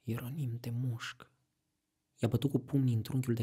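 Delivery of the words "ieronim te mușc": softer whisper with a bite of spite; whispered spiteful threat; quiet spite. Acted. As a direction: hiss it under your breath in pique. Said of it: "zise încet și cu un fel de ciudă".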